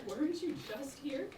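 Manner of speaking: hushed suspicious whisper. Real, not acted. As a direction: whisper it under your breath. Suspicious aside to villain.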